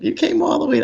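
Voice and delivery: a retold, amazed "all the way?"; mock surprise. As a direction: squeak it out in disbelief. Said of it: "And they're like".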